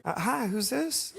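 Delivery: hesitant, lifted voice on the phone. Retold or imitated.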